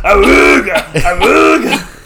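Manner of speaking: loud, slow cartoon awooga; awooga horn noise. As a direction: blare it like a car horn. Silly horn noise after laughing.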